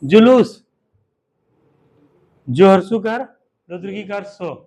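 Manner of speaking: जुलूस called out loud and drawn out for the class; loud dictation. Shown as a demonstration. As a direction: call out जुलूस so the back row hears. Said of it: "चलो अगला लिखो".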